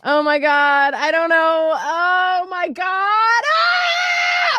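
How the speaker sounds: high, loud panicked wail; reenacted panic. Mother reliving the news in her car, acted panic. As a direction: wail upward into a dragged-out scream. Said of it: "I'm like, panic. I'm panicked".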